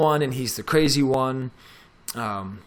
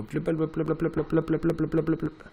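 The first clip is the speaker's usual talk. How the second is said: higher-pitched run of rapid-fire blips; rapid blipping noise; 4 semitones higher, 3 dB quieter than usual. Shown as a demonstration. Teacher filling the wait while software opens, rapid blipping. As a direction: babble "blip" over and over, evenly. Imitates slow loading as he waits.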